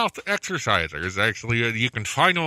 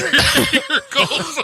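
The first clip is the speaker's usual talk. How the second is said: laughing; 11 semitones higher, slower than usual. Real, not acted. Joking about cold sores.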